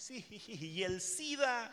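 ordinary talk, nothing marked

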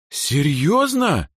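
high, long-drawn disbelieving question; incredulous. A narrator's reading. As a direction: stretch the word out in amazement. Astonished reaction to the news.

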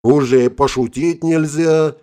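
loud, strained wheeze after a punch; wheezing. A narrator's reading. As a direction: choke it out, winded and hurt. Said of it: "захрипел".

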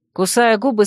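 ordinary delivery, nothing marked

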